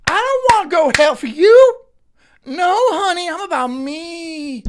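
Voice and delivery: high, loud mock of a sassy woman; mocking put-on voice, stretched at the end; mocking imitation. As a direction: mimic her in a high, exaggerated voice. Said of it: "She, she clapping, she's being".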